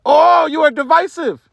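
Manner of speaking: high, loud, slow mocking whine; mock accusation. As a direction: parody the critics crying foul. Mocking critics' reaction.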